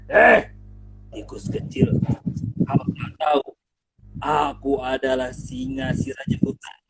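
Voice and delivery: low, threatening lion growl; menacing lion. Acted. Singa mengancam akan memakan tikus. (singa mengancam tikus, suara rendah menggeram)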